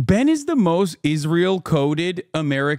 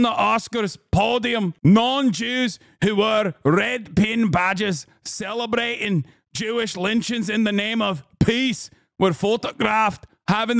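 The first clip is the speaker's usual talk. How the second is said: higher, earnest mock-Scottish reading; Scottish accent impression; 3 semitones higher than usual. Mocking Eve Fartlow's post in a Scottish accent. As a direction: ham up her Scottish outrage. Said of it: "that's what she sounds like".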